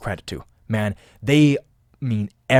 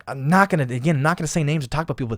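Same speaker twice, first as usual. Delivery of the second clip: normal talking voice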